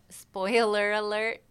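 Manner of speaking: slow, drawn-out words; funny and drawn out. Real, not acted. Singer warning the host of a spoiler, drawn out. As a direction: stretch the words out oddly. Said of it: "said that really funny and drawn out".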